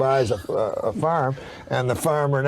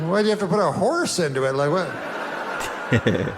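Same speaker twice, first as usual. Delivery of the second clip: exasperated retort; 5 semitones higher than usual. Retold or imitated. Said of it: "So I was like".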